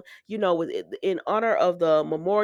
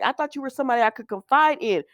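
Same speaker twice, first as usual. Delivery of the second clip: retold upset plea, higher and quick; scared, emotional; 6 semitones higher, 3 dB louder, faster than usual. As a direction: act out her emotional reproach, lightly. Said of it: "Summer is getting scared and".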